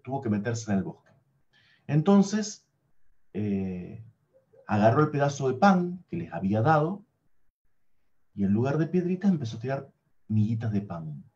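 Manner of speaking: ordinary delivery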